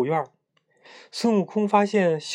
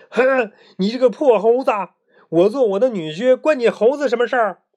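loud, high-pitched scolding; huff, then berate at raised pitch and volume; angry cursing; 7 semitones higher, 5 dB louder than usual. A narrator's reading. Pig demon curses Monkey King at cave mouth, angry loud scolding. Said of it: "跳出来破口大骂说".

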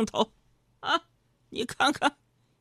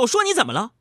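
high, loud rant at the wife; raised-voice threat rattled off; mock-angry tirade; 5 semitones higher, 5 dB louder, faster than usual. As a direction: scold at full tilt, comically blustering. Acted threat turning into flattery.

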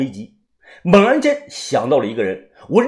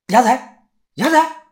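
high, urgent calls of a name; frantic high calling; calling out in alarm; 9 semitones higher, 3 dB louder than usual. A narrator's reading. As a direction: call his name, rising in panic. Shaking the poisoned friend awake.

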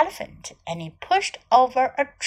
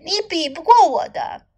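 usual speaking voice

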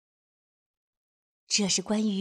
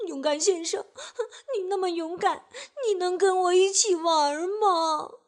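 hammed-up weepy voice; tearful plea; 7 semitones higher, 4 dB louder than usual. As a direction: whine through tears to win a friend. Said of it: "她哭着说".

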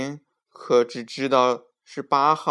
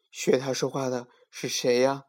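ordinary delivery

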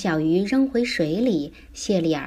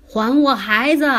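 father's raised, demanding shout; loud shout; 5 semitones higher, 6 dB louder than usual. Acted. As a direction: yell down into the hole. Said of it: "对着洞口大喊".

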